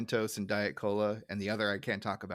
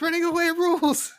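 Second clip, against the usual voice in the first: much higher, louder cartoon squeal; squeaky mock Nelson; 6 dB louder than usual. Host imagining fleeing a bar fight, squeaky mock cheer. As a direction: chirp it like a gleeful kid running off.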